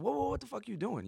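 high startled mob boss shout. Retold or imitated. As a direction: stop the kid cold, high and sharp. Retelling mob boss stopping kid.

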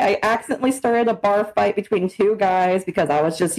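ordinary talk, nothing marked

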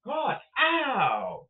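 sudden yelp of pain; pained cry. Real, not acted. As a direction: cry out, hurt.

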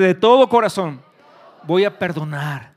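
very loud, emphatic vow, slowing at the end. Real, not acted. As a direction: shout it, then stretch the final words. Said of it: "Dilo más fuerte".

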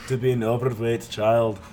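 hammed-up movie character impression; mock-earnest character monologue in a put-on voice; Fat Bastard. Telling friends of an overweight childhood, Fat Bastard impression. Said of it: "I'll do fat bastard".

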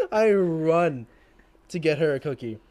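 usual speaking voice

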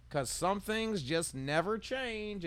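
ad announcer tagline. Retold or imitated. Host closing the commercial with its slogan, announcer style. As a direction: land the slogan like a TV spot.

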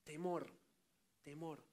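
a hushed, awestruck "Híjole" fading into a whisper of fear; awed fear. Acted. Simón overwhelmed before Jesus's authority, hushed awe. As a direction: let the fear drain the voice to almost nothing. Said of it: "y dijo".

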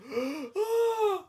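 loud, high-pitched imitated gasp; big acted gasp. Groom acting out his emotion hitting him, loud high gasp. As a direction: gasp sharply as if just punched. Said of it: "I was like".

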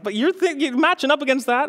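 high-pitched, disbelieving dad; mock incredulity. Dad showing son the giant picture, acted incredulity. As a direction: act out baffled disbelief at the boast. Retells dad doubting four-year-old's boast.

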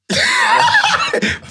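shrieking laugh; high burst of laughter. Real, not acted. Reacts to girlfriend nickname claim.

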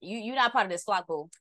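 mock-bossy dismissal, laughing through it; sassy dismissal. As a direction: wave them off with sass, boo.

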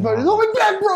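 high-pitched, hammed-up cry to a friend; mock alarmed plea. Retold reaction to furious Brad.

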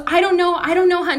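mildly mock-panicked answer to husband; retold fluster. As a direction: imitate a wife too rushed to explain.

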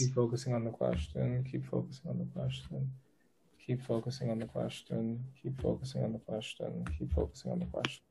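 softly chanted reminder to the client; fading murmur. Real, not acted. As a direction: murmur it like a mantra while shuffling. Repeats mantra while shuffling cards.

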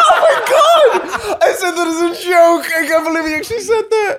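high, loud, helpless laughter; cracking up in amazement; real laughing disbelief. Real, not acted. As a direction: shriek it through laughter, astonished. Joke turns out to be real.

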